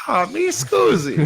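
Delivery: high, slow hammed-up Italian accent; Italian accent impression. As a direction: stretch it out like a comic Italian from Euro Trip. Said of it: "the movie su-, uh, Euro Trip".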